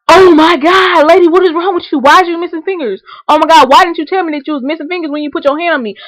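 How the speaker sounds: high, loud mock-horrified outburst; loud pretend shock. Woman faking discovery of the missing fingers, loud and shocked. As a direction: act scandalized and loud at the lady.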